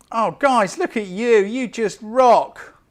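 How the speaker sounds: high, loud and thrilled, slowing on rock; oh, guys, look at you, loud and beaming; excited gush. Real, not acted. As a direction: burst out with real delight at the viewers. Thanked by viewers, gushing.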